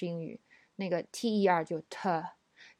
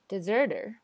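American deserter with r's; American English. Shown as a demonstration. Teacher compares deserter in American English. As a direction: deserter with a flapped t and strong r's. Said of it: "它们只有R的区别吗".

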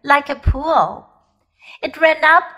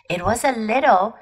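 ordinary delivery

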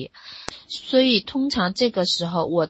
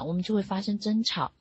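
ordinary delivery

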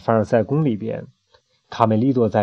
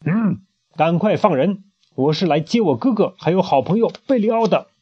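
mild stern demand to the queen; 6 semitones higher than usual. Acted. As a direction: order her to release them. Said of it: "严肃地说".

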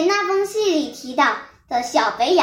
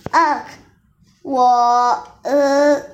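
halting, louder hesitant uh sounds; stammering; 4 dB louder, much slower than usual. A narrator's reading. Cornered wolf fumbling for words, stammering. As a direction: stumble over each syllable, caught out. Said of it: "老狼变得结巴起来".

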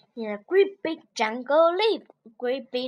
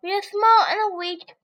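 higher, louder rabbit; 6 semitones higher, 4 dB louder than usual. Acted. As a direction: echo the lion's taunt back at him. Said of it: "said clever Rabbit".

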